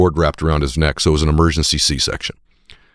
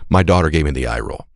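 ordinary talk, nothing marked

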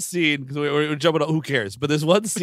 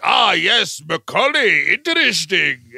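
put-on louder, hammy accented villain; theatrical interrogator; 5 dB louder, slower than usual. Villain greeting his captive, intrigued theatrical accent. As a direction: savour it with a grand accent. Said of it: "he comes in and he is like".

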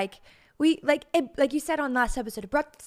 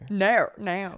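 rough try at an English "no"; mock accent on the vowel of "no"; attempted English accent. Wife trying an accent at husband's prompting, English accent. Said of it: "Try an English accent".

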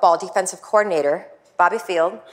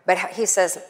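ordinary delivery

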